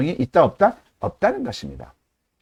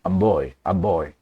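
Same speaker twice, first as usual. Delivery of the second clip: put-on "I'm boy" deep from the belly, lower and slow; 10 semitones lower, 4 dB quieter, much slower than usual. Shown as a demonstration. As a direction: drop "I'm boy" down into the diaphragm. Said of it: "배에서 한번 해보세요". (배에서 울리는 소리로)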